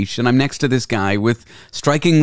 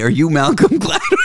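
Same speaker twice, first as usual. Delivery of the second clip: laughing; 7 semitones higher than usual. Retold or imitated. Retelling own question, laughing.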